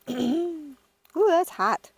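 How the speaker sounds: ordinary talk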